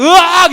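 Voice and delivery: high, loud mock shriek; startled scream. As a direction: shriek as birds swoop in. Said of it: "이렇게 날아가는 거죠".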